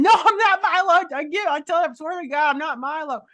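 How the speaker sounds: high and loud, laughing, easing down by the end; exasperated high protest. Retold or imitated. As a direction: squeal the denial, then settle. Retorting to collector calling her Milo.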